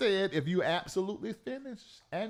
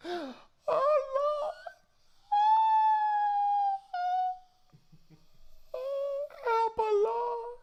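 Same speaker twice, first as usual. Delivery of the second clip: high, tearful mock wail to the Lord; mock crying prayer; much slower than usual. Host mimicking callers on the prayer line, mock sobbing.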